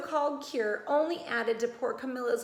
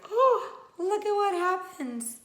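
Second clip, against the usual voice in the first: much higher, louder wonder; excited; 8 semitones higher, 6 dB louder, slower than usual. Real, not acted. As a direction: thrill the little listeners. Narrator excited to children.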